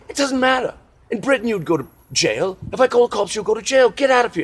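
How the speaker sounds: high loud British telling-off; indignant British man. Retold or imitated. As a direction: order him away in an outraged posh voice. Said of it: "what the guy finally said was".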